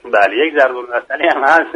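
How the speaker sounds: normal talking voice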